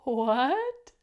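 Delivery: loud rising shocked what; loud surprised what. Shown as a demonstration. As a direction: shout the word What in amazement. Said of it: "Can you do that? Ready?".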